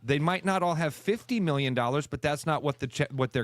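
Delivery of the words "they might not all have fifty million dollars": normal talking voice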